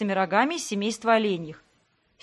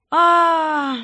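loud, high anguished cry; frustrated scream; 7 semitones higher, 8 dB louder than usual. Acted. Heroine cries out and grabs her head. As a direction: scream out in exasperation.

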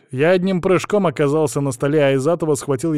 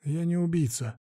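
soft, higher-pitched calm reply; calm objection; 5 semitones higher, 6 dB quieter than usual. A narrator's reading. Captor reassuring the poisoned hostage, calm. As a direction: object quietly and evenly. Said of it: "спокойно возразил я".

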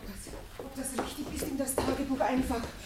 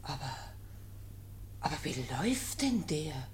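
low, hushed whisper of alarm; worried whisper; 7 semitones lower, 7 dB quieter than usual. Acted. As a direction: whisper it, watching him stagger. Watching him stagger. (besorgt geflüstert)

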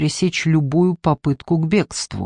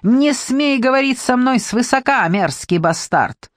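furious; 6 semitones higher than usual. A narrator's reading. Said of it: "с такой яростью".

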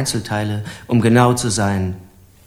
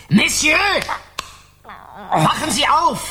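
shout to wake; 12 semitones higher than usual. Acted. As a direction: shake him awake with your voice. Wakes unconscious man, shouting. (lauter Weckruf)

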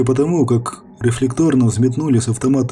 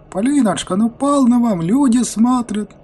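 гораздо выше, смущённо отмахиваясь; смущённый старик-профессор; 12 semitones higher than usual. Acted. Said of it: "Смутился профессор". (высокий смущённый голос профессора)

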